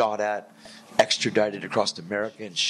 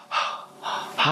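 breathless whisper; 6 semitones higher than usual. Retold or imitated. Star-struck passengers greet him.

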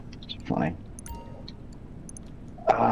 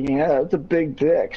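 high-pitched, slow mock excitement; excited console; 10 semitones higher, 4 dB louder than usual. Friend jokes as the console taking a disc, mock-excited. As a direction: draw it out high, hamming up the console's glee.

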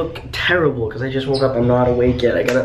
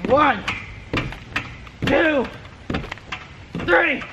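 excited countdown; 12 semitones higher, 4 dB quieter, much slower than usual. Real, not acted. Counting before a dunk.

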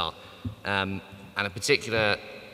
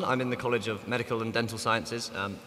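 normal talking voice

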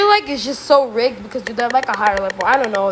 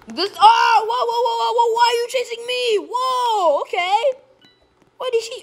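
high-pitched, startled protest; alarmed yelp; 10 semitones higher than usual. Real, not acted. Player chased by a suspected murderer, alarmed. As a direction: yelp in panic at the pursuer.

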